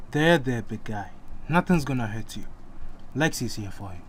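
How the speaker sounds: calm him back to sleep gently; soothing. A narrator's reading. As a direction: Lexi soothes the sleeping boy softly.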